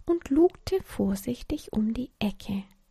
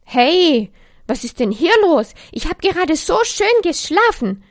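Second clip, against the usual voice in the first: loud, indignant complaint with a raised pitch; cranky outburst, much louder; somewhat angry; 7 semitones higher, 10 dB louder than usual. A narrator's reading. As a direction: grumble loudly at being woken up. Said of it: "Eine etwas wütende Stimme unterbrach die beiden".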